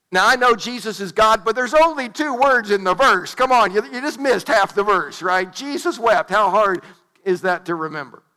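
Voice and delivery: loud, high-pitched comic indignation; mock exasperation. Preacher ribbing a kid who misquoted the shortest verse, mock exasperated. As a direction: scold a forgetful kid in playful disbelief.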